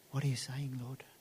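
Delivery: soft unvoiced whisper; a hushed whisper to the Lord; whispered prayer. Shown as a demonstration. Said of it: "the first thing we have to do is go".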